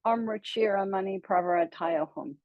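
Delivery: Om Ruchira Mani Pravara Tayohum with the v in Pravara; mantra as heard. Shown as a demonstration. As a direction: say "Pravara" with a clear v, not a w. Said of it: "this is how I've heard it".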